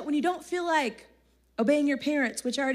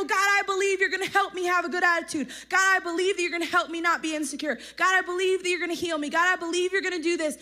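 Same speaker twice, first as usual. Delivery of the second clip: higher and louder, firm repeated believing; bold faith declaration; 6 semitones higher, 4 dB louder, faster than usual. Shown as a demonstration. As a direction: declare it like you mean it.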